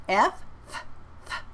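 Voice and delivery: "F" with no vibration, only air; unvoiced F. Shown as a demonstration. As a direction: push air through for "F". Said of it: "is an unvoiced consonant".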